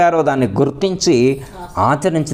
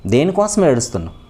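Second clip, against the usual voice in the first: normal talking voice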